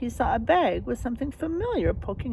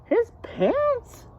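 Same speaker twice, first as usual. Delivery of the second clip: gasp, then much higher and louder, fading to a whisper; mock shocked gasp; 14 semitones higher, 4 dB louder, much slower than usual. Real, not acted. Reader shows the picture to children, gasping then whispering.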